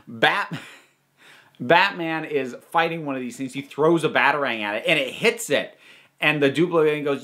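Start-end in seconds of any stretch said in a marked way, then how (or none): none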